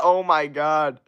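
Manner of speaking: normal talking voice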